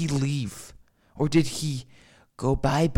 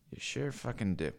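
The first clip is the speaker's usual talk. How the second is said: quiet; 2 semitones lower, 10 dB quieter than usual. Shown as a demonstration. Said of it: "repeat slower".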